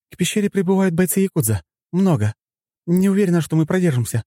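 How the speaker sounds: high-pitched anxious female report; worried woman. A narrator's reading. Woman radioing about arriving fighters, high and anxious. Masha reports enemy reinforcements.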